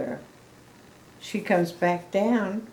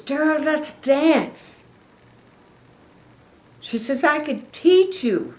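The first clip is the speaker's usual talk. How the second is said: a lightly voiced eager little pig, higher and slow; eager Piggy; 8 semitones higher, 4 dB louder than usual. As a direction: coax him to dance in a higher voice. Piggy's voice in read-aloud story.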